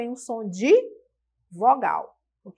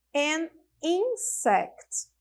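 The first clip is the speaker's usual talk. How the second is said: An insect spelled out very slowly, higher; slow model phrase; 3 semitones higher, much slower than usual. Shown as a demonstration. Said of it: "Então, a palavra inseto". (An insect bem devagar, mais agudo)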